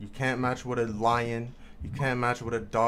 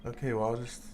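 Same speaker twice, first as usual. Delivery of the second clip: quick and softer; lower level; 3 semitones lower, 5 dB quieter, much faster than usual. Real, not acted. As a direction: rattles it off fast, much quieter. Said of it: "talk at a lower level".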